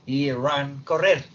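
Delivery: ordinary talk, nothing marked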